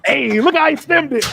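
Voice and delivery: loud, amused hype at a replay; excited. Real, not acted. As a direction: call it out like a fan. Laughing at football clip.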